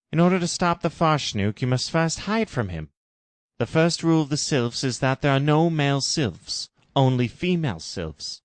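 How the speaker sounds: solemn Jim. Acted. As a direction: warn her as a grave mentor. Jim's harrowing rule.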